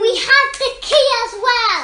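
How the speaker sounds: high, loud, urgent announcement; calling out. Pretend. Child player reassures panicking mouse, shouting high. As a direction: shout it to be heard over the panic.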